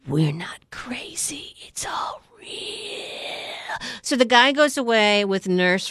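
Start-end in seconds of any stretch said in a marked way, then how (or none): 0.1-3.8 slow secret whisper. Retold or imitated. Stranger in waiting room whispers the truth. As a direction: hush it like a conspiracy. Conspiracy guy confides fearfully.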